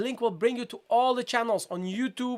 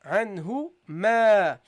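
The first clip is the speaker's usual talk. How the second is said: slow, drawn-out "ma"; demonstrated recitation; 4 dB louder, much slower than usual. Shown as a demonstration. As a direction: model it slowly, holding the final "ma". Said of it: "so you say".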